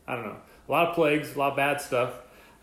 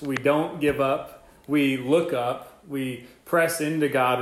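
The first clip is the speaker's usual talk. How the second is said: slow rallying lines; slower than usual. Acted. Preacher rallying the listeners.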